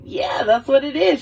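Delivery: high, eager acted confirmation; mock-thrilled yes; stoked ex-girlfriend. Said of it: "She was, like, so stoked about it".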